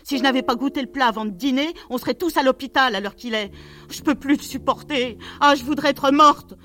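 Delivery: high, overwrought rant; exasperated tirade. A narrator's reading. Wife railing about useless help at home, exasperated.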